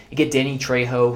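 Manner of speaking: usual speaking voice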